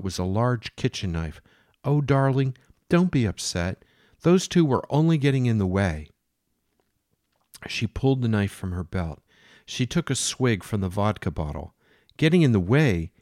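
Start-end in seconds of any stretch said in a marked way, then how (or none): 1.8-6.1 higher, louder voice for Jane; a mad woman serving the captain breakfast. Acted. 12.2-13.1 loud, higher angry echo; captain raging at Jane; furious disbelief. Acted.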